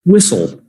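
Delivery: "Whistle" with the T left silent. Shown as a demonstration. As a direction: say "Whistle" as WISS-ul, no T sound. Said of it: "That T is silent".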